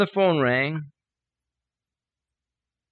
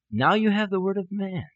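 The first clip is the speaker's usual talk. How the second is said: chiding; 6 semitones higher than usual. Retold or imitated. God chides him for trusting man.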